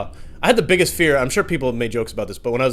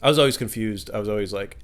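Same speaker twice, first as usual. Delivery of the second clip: usual speaking voice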